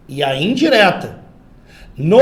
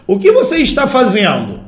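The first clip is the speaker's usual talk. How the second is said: higher, emphatic direct question; 5 semitones higher, 4 dB louder than usual. Shown as a demonstration. Teacher models a direct question for students, direct questioning tone. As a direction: read it as a question put straight to someone. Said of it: "Olha a leitura da primeira frase".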